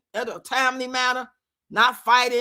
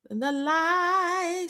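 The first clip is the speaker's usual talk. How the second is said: very slow, hushed, eerie, each word drawn out; spooky ghost voice; 4 semitones higher, 4 dB quieter, much slower than usual. Pretend. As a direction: beckon like a ghost from beyond. Ghostly voice from the movie.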